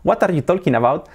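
every word sounded out, "talking" as "TOL-kin"; his old Spanish-accented English; learner's Spanish accent. Shown as a demonstration. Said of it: "Yo antes hablaba".

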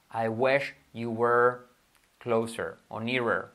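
very slow, drawn-out English example; slow model sentence. Shown as a demonstration. Teacher models English example for learners, slowly. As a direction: spell out each word unhurriedly.

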